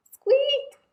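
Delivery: very high squeak; mouse squeak. Acted. The hidden mouse squeaking at Owl, high and tiny.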